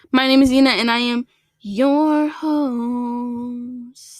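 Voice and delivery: host intro voice. Acted. Podcaster retaking her intro in a slow host voice. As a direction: announce yourself like a show host, dragging the last words. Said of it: "welcome back to the King Nut Queen podcast".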